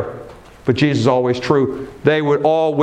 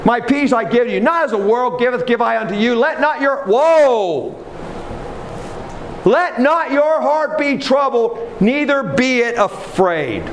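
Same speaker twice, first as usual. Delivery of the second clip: high-pitched, emphatic preacher's proclamation; impassioned preaching; 8 semitones higher than usual. Real, not acted. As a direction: proclaim the promise, leaning hard on key words.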